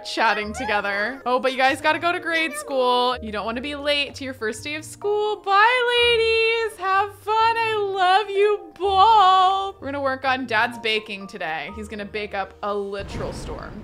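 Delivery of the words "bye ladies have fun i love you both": put-on high, sung-out farewell to the kids; sending the twins off with a lilting goodbye; singsong goodbye. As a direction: sing them off to school, bright and loving.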